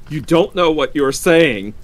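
protest, shaken by the wife's idea; unsteady. A narrator's reading. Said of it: "he said unsteadily".

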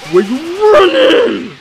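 high, loud, slow taunt; drawn-out jeer at the fleeing enemy; taunting the fleeing foe in slow mockery; mocking taunt.

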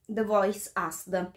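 usual speaking voice

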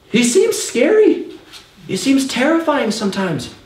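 high, slow, small scared voice; mock fearful. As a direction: whimper it like a frightened kid, lightly. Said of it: "And I thought".

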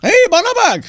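high-pitched, commanding hail; calling out. Acted. Troll hunter calling after someone, shouting for attention. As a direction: yell for their attention.

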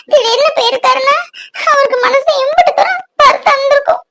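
high-pitched sobbing lament; tearful wailing. Acted. Girl grieving to her friend Ponni over Karthik leaving, tearful wailing. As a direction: cry through every phrase, voice pitched far up. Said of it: "அழாத".

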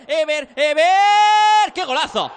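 golazo scream. Real, not acted. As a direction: stretch out the golazo yell. Second goal, elongated golazo cry.

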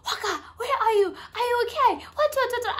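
high, frantic mock panic; panicked friend calling after hearing of the crash; panicking friend. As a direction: imitate a friend panicking down the phone. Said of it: "She's panicking".

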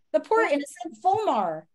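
ordinary delivery

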